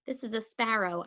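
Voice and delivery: usual speaking voice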